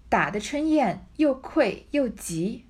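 normal talking voice

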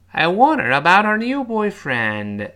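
high, loud linked model. Shown as a demonstration. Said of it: "快一点".

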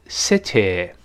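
"city" ending in eh instead of ee; wrong final vowel. Shown as a demonstration. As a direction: mispronounce "city" as "sit-eh". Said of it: "不要读city".

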